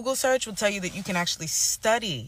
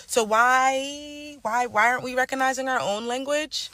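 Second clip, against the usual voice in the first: higher, pressing why, why; indignant why; 6 semitones higher than usual. Real, not acted. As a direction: demand an answer, exasperated. Indignant question.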